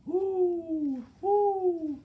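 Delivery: very high, loud, long hoots; owl hooting. A narrator's reading. Reader to children as the owl, high hooting. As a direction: hoot it like an owl. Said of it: "asked the owl".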